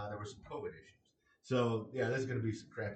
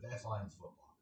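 "That's Lions football" repeated softer and lower, trailing off; quiet repeat; 3 semitones lower, 6 dB quieter than usual. Real, not acted. Repeating his view of the Lions, softly resigned. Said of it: "That's the way I view it".

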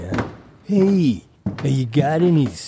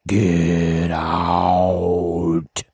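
ghostly voice; 8 semitones lower than usual. Acted. Ghost voice on the recorder.

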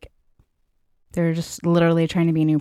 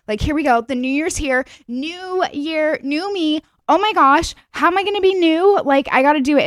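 much higher, giddy mock excitement; imitating New Year hype; 9 semitones higher than usual. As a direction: play the overhyped resolution maker. Acting hyped New Year person.

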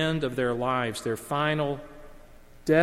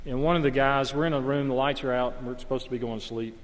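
ordinary talk, nothing marked

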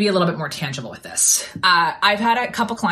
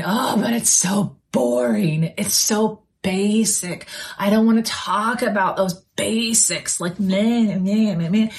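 slow, drawn-out mock complaint; eye-rolling whine; 2 semitones higher, slower than usual. Entrepreneur griping about talking about the basics, mocking eye-rolling whine. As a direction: roll your eyes through every dragged-out word. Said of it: "rolling your eyes and going".

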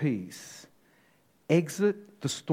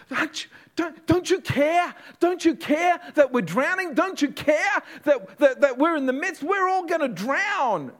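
much higher and louder, desperate pleading; panicked cry; 13 semitones higher, 7 dB louder than usual. Acted. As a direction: cry out as if the boat is sinking. Panicked disciples wake Jesus.

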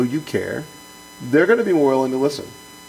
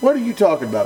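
higher-pitched, exasperated retort; a hint of a young officer losing patience; frustrated outburst; 9 semitones higher, faster than usual. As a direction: demand an answer, fed up with the boss. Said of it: "I got frustrated with him".

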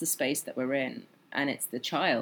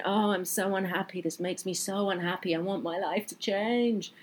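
mock pained whining; 5 semitones higher than usual. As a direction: wail it like a self-pitying client, stretching the last words. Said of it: "they're crying out in pain".

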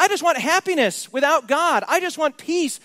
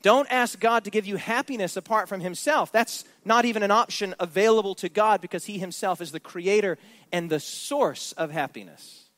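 ordinary talk, nothing marked

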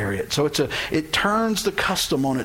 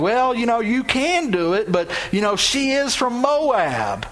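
sly insinuating warning; 6 semitones higher than usual. Acted. Boaz talks cousin out of it.

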